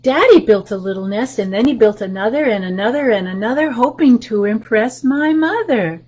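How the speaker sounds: lively picture-book read-aloud; reading to children. Acted. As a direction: read the rhyme to the little ones.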